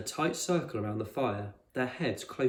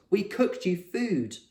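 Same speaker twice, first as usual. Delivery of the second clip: commanding; 8 semitones higher, 4 dB louder, slower than usual. A narrator's reading. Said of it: "It was an order, not a request".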